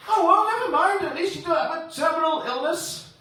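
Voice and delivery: very high, exaggerated sing-out reassurance; breezy consoler. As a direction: console brightly, far above your own pitch. Consoling friend in acted dialogue.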